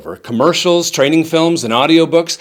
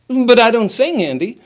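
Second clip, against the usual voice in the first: higher-pitched mock protest from a student; 7 semitones higher than usual. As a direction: object like a reluctant student. Voices a student's objection.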